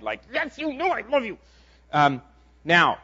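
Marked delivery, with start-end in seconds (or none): 0.3-1.4 much higher, exasperated mock whine; annoyed Peter. Peter, tired of being asked, exasperated. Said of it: "Peter finally gets really annoyed".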